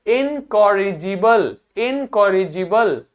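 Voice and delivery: इनकॉरिजिबल spoken slowly, syllable by syllable; slow model pronunciation. Shown as a demonstration. Teacher drills इनकॉरिजिबल slowly with students. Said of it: "तो क्या हो जाएगा".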